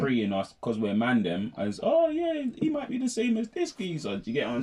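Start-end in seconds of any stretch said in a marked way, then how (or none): 1.8-4.2 high mocking quote voice. Voicing suspicious outsiders.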